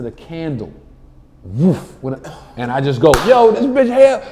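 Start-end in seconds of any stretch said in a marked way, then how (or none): 3.3-4.2 high, loud mock alarm; instant stage riff. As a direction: holler it out to the whole room.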